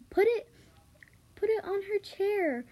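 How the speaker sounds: high, loud call across the kitchen; a sudden high, loud order; mother calling out. A narrator's reading.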